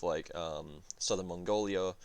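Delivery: ordinary talk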